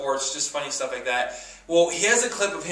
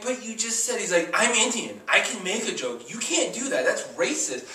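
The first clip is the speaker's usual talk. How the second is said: mock outrage; 7 semitones higher than usual.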